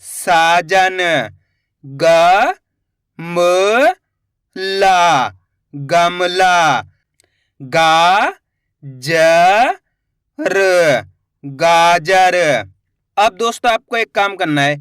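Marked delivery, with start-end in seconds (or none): 1.8-12.4 each syllable drawn out, then the whole word; slow spelling-out teacher voice. Shown as a demonstration. Teacher sounding out Hindi syllables for children, slow and drawn out. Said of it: "teacher sounds out syllables".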